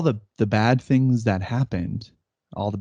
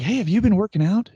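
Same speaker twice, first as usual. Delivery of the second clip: impressed coworker; 10 semitones higher, 3 dB louder than usual. Retold or imitated.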